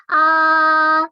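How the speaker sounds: A held long on a high, level tone; first tone, flat. Shown as a demonstration. (A nada datar, tinggi dan panjang)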